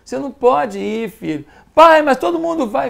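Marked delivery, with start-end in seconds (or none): 1.8-2.9 put-on higher, louder whiny protest of a teen; a son complaining to his dad, higher and louder; whining teenager. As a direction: plead like a kid who says everyone else gets to go. Child protesting to father.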